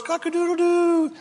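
high mock rooster crow; rooster crow imitation.

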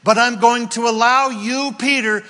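God reassuring Peter, shouting; impassioned shout. Acted. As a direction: preach God's promise at full force. Preacher voices God to Peter.

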